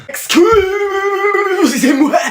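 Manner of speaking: high, slow, laughing "Excusez-moi"; high drawn-out laugh of embarrassment; laughing apology. Acted. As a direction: stretch it out through laughter. Teacher laughing at twins reveal.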